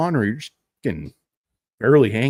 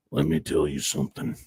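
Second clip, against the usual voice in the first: low, gruff threat; Sam Elliott growl; 7 semitones lower, 4 dB quieter than usual. Pretend. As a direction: menace in a low rumble. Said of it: "Sam Elliott just randomly threatening people".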